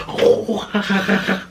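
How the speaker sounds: high, held-back mock scream; imitated screaming. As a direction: mimic a screamer running at you. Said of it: "all of them start screaming".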